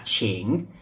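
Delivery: "qing" dipped low then rising; third tone on qing. Shown as a demonstration. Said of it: "which is the third tone".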